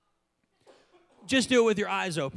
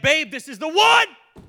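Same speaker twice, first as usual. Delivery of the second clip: much higher and louder, thrilled; excited certainty; 7 semitones higher, 8 dB louder than usual. Retold or imitated. Husband telling his wife he's found the house, thrilled. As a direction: gush to your wife about the house. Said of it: "I was like".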